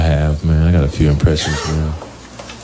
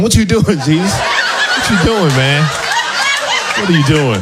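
high surprised voice; 10 semitones higher than usual. Retold or imitated. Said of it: "I'm like".

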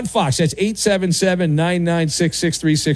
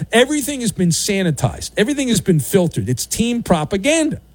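ordinary delivery

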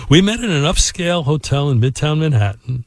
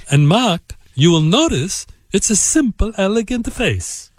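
high, lilting mock Italian accent; imitated Italian accent, slowing at the end; 5 semitones higher than usual. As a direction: put on an Italian accent, savouring the words. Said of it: "in this accent".

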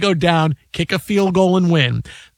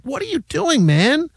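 exasperated rant; 11 semitones higher than usual. Real, not acted. Host ranting at the head coach for settling, exasperated and high. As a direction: demand what he was thinking.

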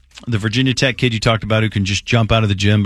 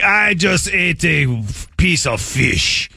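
mock Russian accent; 5 semitones higher, much slower than usual. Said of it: "bunch of clear in your throat".

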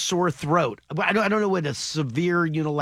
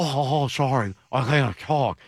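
lower, mouth full of hot potatoes; 4 semitones lower than usual. Shown as a demonstration. Host demonstrates a throat symptom for co-hosts, hot potato voice. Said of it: "like your mouth is full of potatoes".

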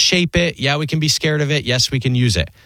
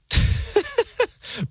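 high, soft chuckle; brief giggle at your own question; a quiet, high-pitched laugh; 5 dB quieter than usual. Real, not acted. Host laughs after asking if done.

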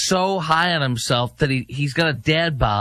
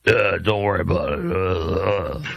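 low, dopey evasive candidate; mock candidate impression; 6 semitones lower than usual. Host voicing the candidate caught out, mock evasive voice. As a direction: brush it off in a low, quick mumble. Answers his own challenge as the candidate.

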